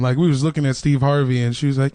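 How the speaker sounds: ordinary talk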